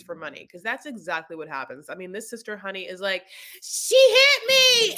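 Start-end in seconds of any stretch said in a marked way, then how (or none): 3.6-4.9 much higher, louder, slow drawn-out wail; hammed-up fake victim. Sister faking a car injury for money, hammed-up wail. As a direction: overact the injury claim. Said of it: "hits the car and then falls".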